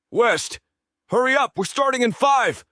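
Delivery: high shouted call across the ice. A narrator's reading. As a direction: yell it to a teammate far away. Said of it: "Lucas calls out".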